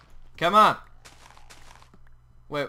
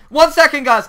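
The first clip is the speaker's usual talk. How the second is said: hurried; 10 semitones higher, 13 dB louder than usual. Real, not acted. Rushing off.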